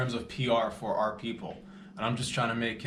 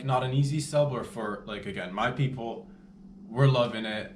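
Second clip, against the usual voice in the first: ordinary talk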